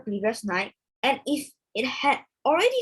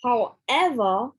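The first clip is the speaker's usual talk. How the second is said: slow however; slower than usual. Shown as a demonstration. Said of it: "One more time".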